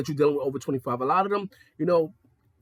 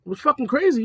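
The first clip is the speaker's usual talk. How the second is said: ordinary talk, nothing marked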